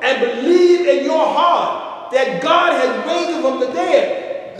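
loud, high, sung-out preaching; fiery gospel preacher calling out; preaching at full force. Acted. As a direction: whoop it to the congregation, drawing words out.

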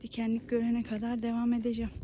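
ordinary talk, nothing marked

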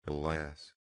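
low, soft tearful alas; a muted, grieving alas; tearful sigh. A narrator's reading. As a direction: let the tears weigh it down. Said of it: "I shed tears".

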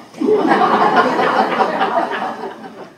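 short breathy laugh at herself; wry unvoiced chuckle; rueful laugh. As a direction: laugh off the joke about aging. Laughs at her own aging joke.

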